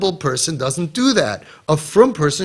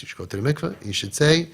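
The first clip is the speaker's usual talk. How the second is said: normal talking voice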